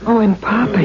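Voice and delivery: usual speaking voice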